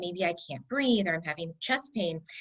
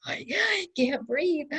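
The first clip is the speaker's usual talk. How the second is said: a higher, strained plea; mildly acting out gasping panic; imitating her own breathless panic; retold panic; 8 semitones higher, slower than usual.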